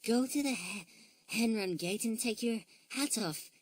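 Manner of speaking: high bear voice, a touch out of breath; puffed from chasing hens; lightly panting Tessie. A narrator's reading. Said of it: "panted Tessie at last".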